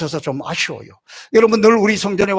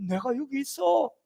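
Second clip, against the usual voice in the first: God's tender reassurance; 4 semitones higher, 9 dB quieter than usual. Acted. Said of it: "내 어깨를 두드리면서".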